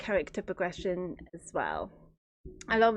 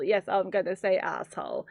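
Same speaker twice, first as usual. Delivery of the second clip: ordinary talk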